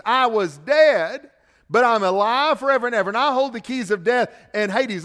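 emphatic repetition. Acted. Preacher repeats the quote, louder.

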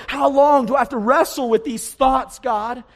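high, loud anguished question; crying out to God. Acted. Psalmist wrestling with his thoughts, crying out to God. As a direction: call it out with full force. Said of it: "that's what David's crying out".

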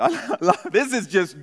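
laughing through the words. Real, not acted. Preacher laughing at a gross Bible story. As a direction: chuckle as you say it.

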